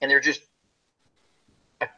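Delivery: ordinary talk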